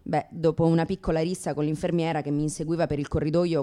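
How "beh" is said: normal talking voice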